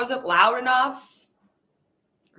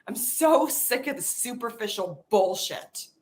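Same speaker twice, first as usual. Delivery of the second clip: normal talking voice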